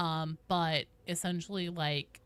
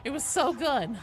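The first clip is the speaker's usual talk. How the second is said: higher, louder, building excitement; excited gushing; 5 semitones higher, 8 dB louder than usual. Real, not acted. Player reminiscing to stream about a perfected strategy, excited gushing. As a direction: gush loudly, pitch climbing with excitement.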